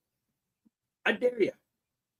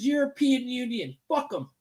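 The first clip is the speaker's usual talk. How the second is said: ordinary talk, nothing marked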